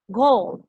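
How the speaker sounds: goal with a strong pronounced G; stronger G. Shown as a demonstration. Teacher repeating goal for the class. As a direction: hit the G of goal firmly. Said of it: "más pronunciado, más fuerte".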